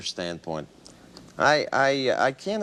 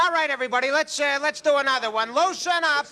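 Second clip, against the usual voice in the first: hammed-up director; 10 semitones higher, faster than usual. Director cheering on his crew before the take, high and hearty. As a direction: pump up the cast, voice pitched high.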